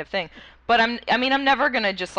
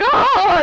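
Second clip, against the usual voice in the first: sudden high yelp of panic; startled yelp; 13 semitones higher, 10 dB louder than usual. Retold or imitated. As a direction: yelp out in surprise. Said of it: "you're like".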